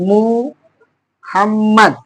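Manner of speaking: "মুহাম্মদ" with a strong breathy হ, drawn out very slowly; breathy h sound. Shown as a demonstration. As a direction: stretch "মুহাম্মদ" and push air on the হ. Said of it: "এখানে এই হাটা উচ্চারণ করা হয়েছে". ("মুহাম্মদ" শব্দে হ-এর জোরালো শ্বাস, খুব ধীরে)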